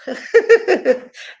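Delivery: laughing at the irony; loud high laugh. Real, not acted. Laughing after naming BlackRock.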